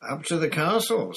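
high, smooth mocking echo; silky sneer. A narrator's reading. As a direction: purr it back at him. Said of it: "said Snape silkily".

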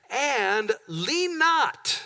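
and lean not, drawn out very slowly; slow stressed lean not. Shown as a demonstration. Pastor to congregation, slowly stretching lean not. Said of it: "Everybody say".

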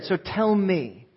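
very slow, deliberate challenge; slow pointed question. Retold or imitated. The speaker challenges the audience, very slow and deliberate. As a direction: lay out each word slowly as a challenge. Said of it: "and I'll never forget".

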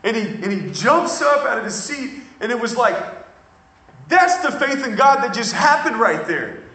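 high, loud, fervent preaching; preaching at full force. Acted. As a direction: carry the room with rising fervour.

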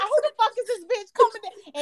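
high, hammed-up indignant yell; mock outrage. Retelling seeing the stranger at grandma's door, mock outraged high. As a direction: pitch it way up like you can't believe who showed up. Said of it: "I'm like".

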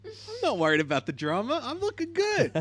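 high-pitched mock self-admiration; hammed-up, high "looking good" brag; imitating carefree contestant. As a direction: voice her as breezily pleased with her looks, pitch climbing at the end. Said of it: "Yeah, she's like".

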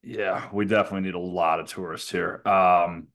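ordinary delivery

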